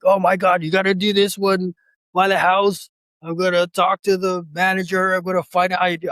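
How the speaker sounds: imitating his excited wife's pitch. Husband retelling his wife's eager plan. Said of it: "my wife was like".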